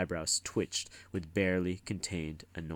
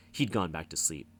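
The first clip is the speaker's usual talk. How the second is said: ordinary delivery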